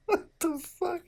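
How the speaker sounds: real shrieking laugh; laughing disbelief. Real, not acted. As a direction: crack up helplessly. Losing it at the fanfic.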